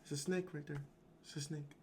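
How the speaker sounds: quiet, flat and emotionless; flat mumble. Retold or imitated. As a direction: say it with no alarm at all. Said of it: "you got that guy like".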